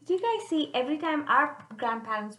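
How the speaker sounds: usual speaking voice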